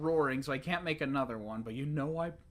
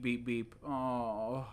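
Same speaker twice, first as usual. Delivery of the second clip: ordinary talk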